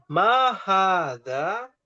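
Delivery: slow, drawn-out question; slow model question. Shown as a demonstration. Teacher drills the Arabic question slowly.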